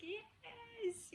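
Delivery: high squeal of joy; mock childlike yes; excited child. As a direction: burst out like a thrilled kid. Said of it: "she was so excited".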